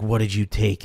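low, pissed-off mom questioning; imitated restrained anger. Mom confronting her kid upstairs, low restrained anger. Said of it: "she was fucking pissed".

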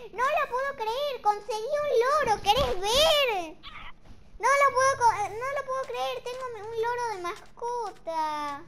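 can't-believe-it excitement; excited disbelief. Real, not acted. Player showing viewers his new parrot, excited shout.

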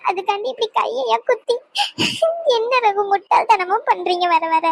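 hurt, crying reproach; tearful. Acted. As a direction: plead through tears over his injury. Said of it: "இப்ப எதுக்குடி அழுதுகிட்டு இருக்க". (அழுதுகொண்டே ஆதங்கமா)

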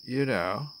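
usual speaking voice

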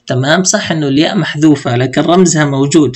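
ordinary talk, nothing marked

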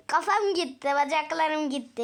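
ordinary talk, nothing marked

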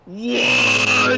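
very high, loud shout of disbelief; incredulous shriek. Real, not acted. Co-player reacting to talk of killing, incredulous shriek. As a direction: shriek it, stretched out in real shock. Louder shock at the twist.

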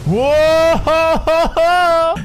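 long, high whoop tipping into laughter; amazed whoop. Real, not acted. Gamer reacting to a wild leap in game, amazed whoop. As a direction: let out a drawn-out, high whoa.

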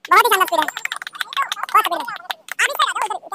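ordinary talk

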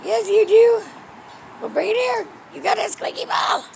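put-on high, bright baby talk to a cat, bursting in places; high pet talk. Owner praising her cat's new squeaky ball, high baby talk. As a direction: coo at the kitty about her new toy.

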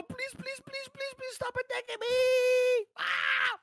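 high, frantic pleading rising to a cry; play-acted terrified plea; panicked begging. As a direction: beg for mercy in a high-pitched panic. Begging Spider-Man to stop attacking.

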